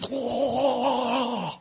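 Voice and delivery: long, high quake-struck cry; mock wobbly whoa. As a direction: draw it out as the ground shakes. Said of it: "And so he's like".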